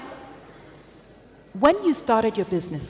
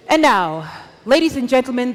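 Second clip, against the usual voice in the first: booming announcer; 3 semitones higher, 4 dB louder than usual. Acted. Host announcing to the audience.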